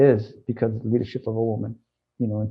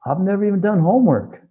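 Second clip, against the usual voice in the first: much higher, stunned question; shocked at the news, pitch way up; retold disbelief; 7 semitones higher than usual. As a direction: janitor told to present, high and disbelieving. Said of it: "my heart sunk".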